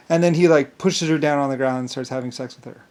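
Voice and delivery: ordinary delivery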